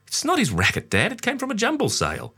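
put-on very high little boy voice; child arguing with dad. A narrator's reading. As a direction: pitch it up like a young kid.

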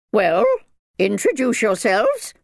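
loud, high-pitched bossy minder; commanding. A narrator's reading. Minder to the children on arrival, commanding. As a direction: order them about, high and loud. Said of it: "Missus Malavant commanded".

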